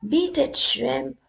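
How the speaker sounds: loud, high barked threat; loud bullying dog voice; growling Doberman. Acted. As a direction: snap it at him like a big dog. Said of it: "growled a Doberman".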